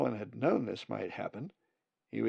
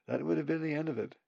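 ordinary delivery

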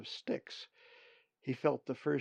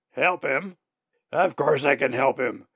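put-on old raven voice; 2 semitones higher, 8 dB louder than usual. Old raven Uriah answers.

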